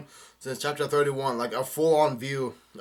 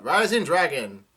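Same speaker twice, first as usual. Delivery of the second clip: slow, higher, louder call; drawn-out raised shout; yelled attack name; 4 semitones higher, 6 dB louder than usual. A narrator's reading. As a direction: stretch it out like announcing a special move. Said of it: "Kanjuro yells out".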